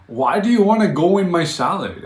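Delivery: high, loud, baffled question; can't believe what they're asking; incredulous. Real, not acted.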